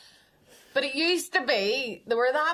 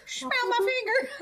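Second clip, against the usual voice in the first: high, laughing creepy voice; a hint of a dirty old man; creepy old pervert. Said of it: "Herbert the pervert".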